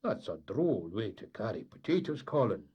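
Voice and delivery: plain reading voice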